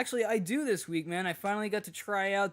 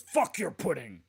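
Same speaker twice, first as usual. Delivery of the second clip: slow, menacing Fuck your pudding; movie thug line; 4 dB louder, slower than usual. Retold or imitated. Acting the cafeteria fight line, slow and menacing. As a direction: drag it out like a cafeteria bully. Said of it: "And the guy goes".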